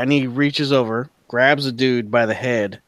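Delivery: ordinary talk, nothing marked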